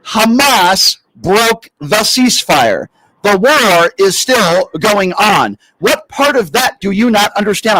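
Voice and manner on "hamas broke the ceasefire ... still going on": each word slowly and heavily spaced. Shown as a demonstration. Host to caller, spelling it out very slowly. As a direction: talk slowly as if to a child. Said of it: "I'm trying to speak very slowly".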